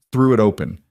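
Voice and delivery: usual speaking voice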